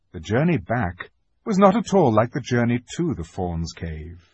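usual speaking voice

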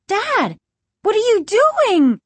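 put-on high, loud, panicked girl; frantic high-pitched shout to dad; alarmed cry. A narrator's reading. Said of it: "Margaret cried".

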